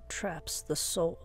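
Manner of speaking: ordinary delivery, nothing marked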